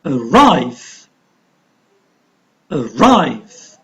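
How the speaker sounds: Arrive with RIVE long, rising then falling; Arrive, a-RRIVE, careful teaching demo; stressed second syllable. Shown as a demonstration. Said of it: "going to pronounce louder and longer".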